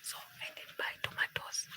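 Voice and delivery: soft unvoiced whisper aside. Real, not acted. Streamer whispers an aside to the chat, whispering. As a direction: hush it like a secret aside.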